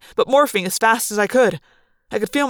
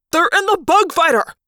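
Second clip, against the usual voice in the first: high-pitched furious shout; howl of rage; 8 semitones higher, 4 dB louder than usual. A narrator's reading. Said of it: "howl in rage".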